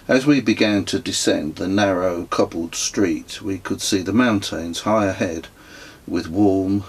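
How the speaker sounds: plain reading voice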